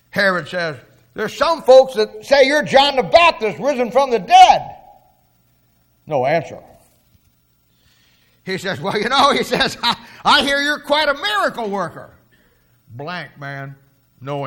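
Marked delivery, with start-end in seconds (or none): 1.1-4.7 loud taunt. Retold or imitated. Herod taunts Jesus about rumours. 8.8-12.1 higher, laughing through a sneering compliment; mocking with a laugh. Herod mocks Jesus with a laugh.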